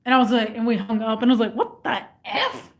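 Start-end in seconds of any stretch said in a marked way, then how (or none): 1.5-2.7 high, slow and soft, in disbelief; stunned disbelief. Retold or imitated. After hanging up on the scammer, high and slow in disbelief. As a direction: let it out as a baffled gasp.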